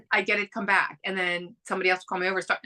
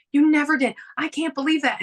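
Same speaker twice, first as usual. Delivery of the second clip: surprised disbelief; 6 semitones higher, 4 dB louder than usual. Retold or imitated. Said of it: "she was like".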